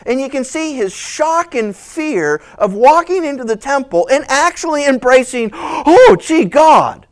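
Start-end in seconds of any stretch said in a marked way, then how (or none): 5.5-7.1 startled high cry to God; shocked gasp. Acted. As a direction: blurt it out in shock. Priest shocked in temple.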